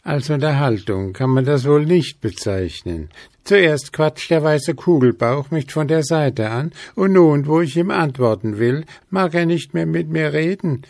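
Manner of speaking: explain, a little put out; owl explaining. A narrator's reading. Willi explains to the ghosts, indignant. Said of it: "erklärte Willi".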